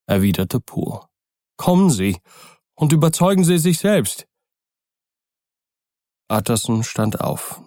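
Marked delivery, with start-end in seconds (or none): 1.6-4.3 higher, fearful butler voice; an old servant whose nerves are fraying; frightened old servant. Acted. Poole urges Utterson.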